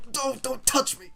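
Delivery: high, sharp flash of anger; gamer rage. Real, not acted. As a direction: snap at the game in frustration. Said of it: "that was another bit of a gamer rage".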